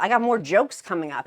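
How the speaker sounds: ordinary talk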